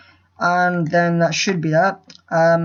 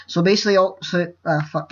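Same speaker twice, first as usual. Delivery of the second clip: usual speaking voice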